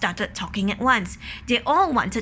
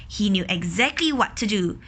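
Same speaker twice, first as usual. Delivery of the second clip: plain reading voice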